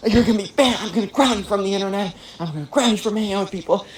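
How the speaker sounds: high, fast mock tirade; hammed-up high-pitched telling-off; scolding mom. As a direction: imitate a mom rattling off punishments. Mom threatens punishment.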